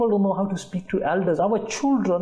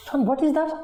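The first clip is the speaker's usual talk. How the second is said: put-on high, childlike innocent question; a father asking like a little child; childish asking; 10 semitones higher than usual. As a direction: ask it like a curious small child. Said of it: "in that childish sort of way".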